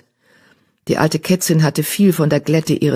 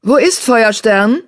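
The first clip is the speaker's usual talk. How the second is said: called out; 7 semitones higher, 4 dB louder, slower than usual. A narrator's reading. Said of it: "rief Brombeerkralle".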